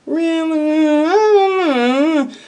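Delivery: skeptical doubt. Retold or imitated. Doubting the fake Irishman, skeptical and slow. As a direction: stretch it out, not buying it. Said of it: "I'm real skeptical".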